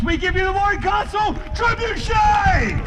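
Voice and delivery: high, loud grand announcement; showman finale shout. Real, not acted. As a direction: present it like a ringmaster.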